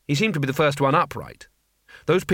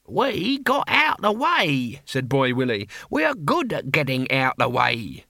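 put-on dim old barbarian. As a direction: drawl it out simply. Boy Willie's voice.

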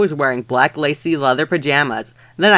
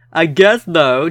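normal talking voice

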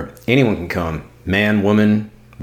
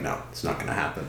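mock dismissive whisper; 5 semitones lower, 14 dB quieter, faster than usual. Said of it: "and then we're like".

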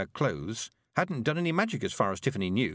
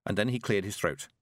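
ordinary delivery, nothing marked

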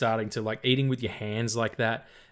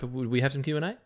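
ordinary talk, nothing marked

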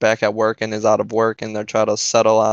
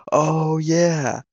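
imitated angry yelling; 6 semitones higher, slower than usual. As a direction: flare up as the outraged woman, pitched high. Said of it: "she starts yelling at me again".

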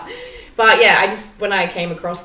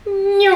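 laughing meow imitation; 12 semitones higher than usual. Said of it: "it was like".

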